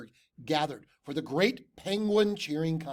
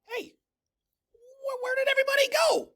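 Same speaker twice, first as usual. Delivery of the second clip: high fast yell; confused shout; 4 dB louder, much faster than usual. A narrator's reading. Tacky lost on the ice, shouting high and fast.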